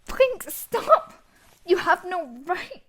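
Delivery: pleading high and loud; desperate shout. Acted. Villain shouting at the judge to stop. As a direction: yell it out, cornered and panicking.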